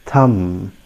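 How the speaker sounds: "thumb" with silent b; low "thumb" held on the m; careful "thumb" without any b; correct pronunciation. Shown as a demonstration. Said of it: "It is pronounced as".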